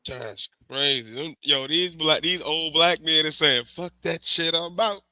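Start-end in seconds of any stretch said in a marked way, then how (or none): none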